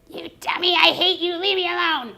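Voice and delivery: loud, high mock outburst; angry child. Upset child to teacher, acted angry yell. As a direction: lash out like an upset kid in role-play. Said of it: "he might tell me I'm stupid".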